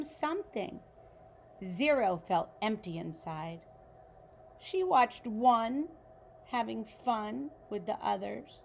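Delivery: put-on reading a picture book aloud to children; story narration. A narrator's reading. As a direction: tell it like a bedtime story for kids.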